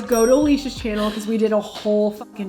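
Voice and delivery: ordinary talk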